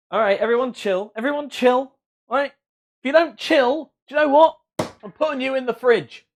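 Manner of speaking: loud, high-pitched mock scolding; mock telling-off. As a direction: play at laying down the law to a rowdy crowd.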